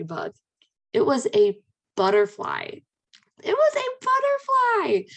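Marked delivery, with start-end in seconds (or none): 3.4-5.0 much higher, slow and delighted; squealed reveal. Shown as a demonstration. Telling listeners the bug was really a butterfly: high delighted squeal.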